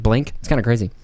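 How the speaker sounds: ordinary delivery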